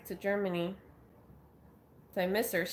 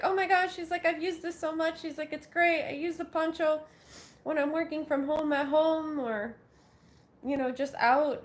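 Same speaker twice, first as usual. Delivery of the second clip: higher, gushing friend voice, lightly done; imitating excited friend; 7 semitones higher than usual. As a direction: play the delighted friend, a little higher. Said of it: "She was like".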